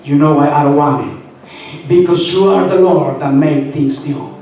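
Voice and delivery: high-pitched, fervent plea to God; impassioned preaching prayer. Retold or imitated. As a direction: build into a ringing, insistent appeal.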